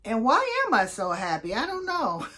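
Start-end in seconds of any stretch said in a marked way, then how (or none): 1.5-2.3 said through a laugh, pitched high. Real, not acted. Wondering aloud why she is so happy, laughing. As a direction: giggle the words out.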